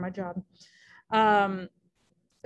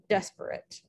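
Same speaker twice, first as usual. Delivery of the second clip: "desperate" stressed on DES, the rest light; DES-per-ate, weight on the first syllable; dactylic stress. Shown as a demonstration. Teacher illustrating a dactyl, "desperate" stressed on DES. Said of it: "stressed, unstressed, unstressed".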